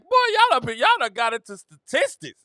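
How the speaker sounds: high incredulous teasing; mock disbelief. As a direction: act amazed they found numbers. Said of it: "I'm like".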